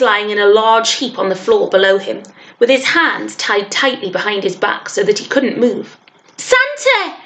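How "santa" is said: put-on very high alarmed boy's cry. A narrator's reading. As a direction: cry out for him, voice leaping up. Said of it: "William cried".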